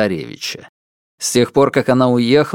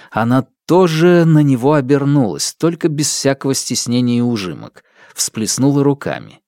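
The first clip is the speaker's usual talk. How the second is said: ordinary delivery, nothing marked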